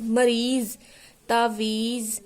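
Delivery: "मरीज" and "तावीज़" each ending on z; word-by-word mareez, taaveez for "मरीज, तावीज़"; z-sound demo. Shown as a demonstration. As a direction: model "मरीज, तावीज़" with a buzzing final z. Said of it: "यहाँ पर जा की ही आवाज़ आ रही है".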